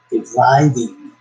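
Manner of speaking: Dividing drawn out slowly; careful reread. Real, not acted. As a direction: slowly stress Dividing to get it right. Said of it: "not depriving".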